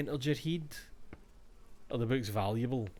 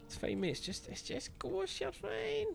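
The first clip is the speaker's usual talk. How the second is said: much higher, softer mock comforting voice; mock reassuring; 5 dB quieter than usual. Gamer mock-soothing the scared character in falsetto. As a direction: coo it falsetto like calming a child.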